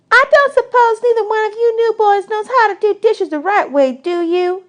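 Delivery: put-on little girl voice. Desi quizzing new boys about dishes, high bossy girl voice. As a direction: boss the new boys around.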